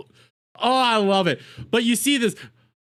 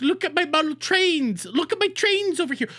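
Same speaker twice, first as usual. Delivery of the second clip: a higher, hammed-up nerdy voice; mocking train enthusiast; 6 semitones higher than usual. Mocking a Lego collector as a man showing off trains, higher and hammed-up. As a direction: show off your trains like a proud collector.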